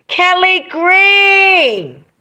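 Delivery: drawn-out high, loud call; excited shout. Real, not acted. Streamer greeting a viewer by name, shouted.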